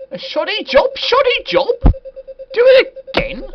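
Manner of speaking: very high, loud squawk of disbelief; incredulous falsetto yelp, last words dragged out; shrill outrage. Acted. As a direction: shriek it back in high-pitched indignation. Outraged at game's verdict.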